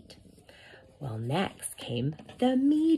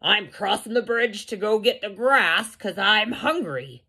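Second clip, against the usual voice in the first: bold, higher goat voice; biggest billy goat; 6 semitones higher than usual. A narrator's reading. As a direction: announce it boldly to the troll. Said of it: "And the billy goat Gruff said".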